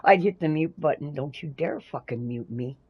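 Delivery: normal talking voice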